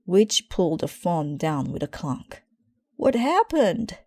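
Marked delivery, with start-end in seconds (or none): 2.9-4.0 high, loud alarmed call; shouted. A narrator's reading. Gran shouting down the phone after the clunk. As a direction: cry out in alarm. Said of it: "shouted Gran".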